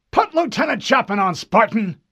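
high, loud irritated command; irritated snap. Acted. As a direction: snap it like a vexed officer. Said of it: "an irritated voice snapped".

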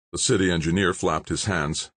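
ordinary delivery, nothing marked

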